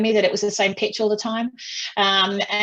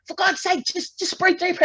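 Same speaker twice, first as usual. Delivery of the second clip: high mock exasperation, husband again; exasperated husband; 10 semitones higher than usual. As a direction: imitate him pleading, pitched high. Husband repeats retort.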